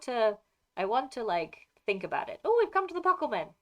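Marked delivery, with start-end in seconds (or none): 2.4-3.5 excited. Real, not acted. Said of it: "excited discovery".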